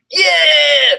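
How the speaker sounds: high loud triumphant yell; roar. Acted. Narrator roaring her answer at the vampire boy. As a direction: roar it out proudly. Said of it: "I roared".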